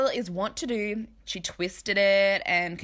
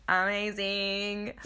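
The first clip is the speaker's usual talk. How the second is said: usual speaking voice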